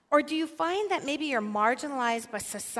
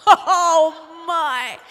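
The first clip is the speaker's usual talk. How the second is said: laughing; 6 semitones higher, 15 dB louder than usual. Real, not acted. Laughs at story punchline.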